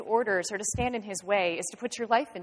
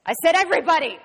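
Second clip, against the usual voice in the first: loud, high bark of authority; angry command; 7 semitones higher, 8 dB louder, faster than usual. Acted. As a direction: shout down the objection. Inspector overrules the reluctant mayor.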